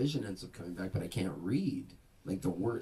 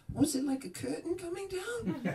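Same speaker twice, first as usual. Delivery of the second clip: piercingly high New Jersey nurse impersonation. Retold or imitated. Nurse questioning blind patient, New Jersey accent. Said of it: "she's this New Jersey nurse".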